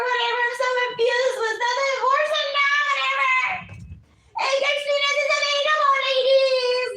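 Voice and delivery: very high, fast, whiny mock voice; high-pitched parody wail of gossiping girls; mocking their whining. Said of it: "That's how they talk".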